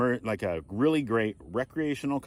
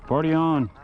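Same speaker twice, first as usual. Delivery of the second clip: higher and louder Wayne's World party cry; playful Wayne's World impression, a bit higher; Wayne's World quote; 7 semitones higher, 6 dB louder than usual. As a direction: toss it off like the movie catchphrase. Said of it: "that's Wayne's World".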